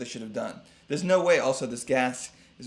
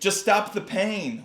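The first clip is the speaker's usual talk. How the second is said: high, slow, louder plea; whimpered; 8 semitones higher, 5 dB louder, slower than usual. A narrator's reading. Fox begs the dentist for relief, whimpering high and slow. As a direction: draw it out as a pained, pleading whimper. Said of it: "whimpered the fox".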